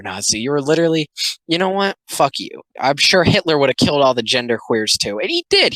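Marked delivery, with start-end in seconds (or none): 5.2-5.7 jumps way up on did; sudden high outburst. Real, not acted. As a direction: blurt it out high and indignant. Ranting sarcasm about Hitler.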